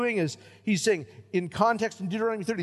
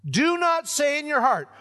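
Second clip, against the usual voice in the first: louder and higher, stress on not; forceful preaching; 9 semitones higher, 6 dB louder than usual. Real, not acted. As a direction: hammer out the verse, punching not. Preacher hammers the verse.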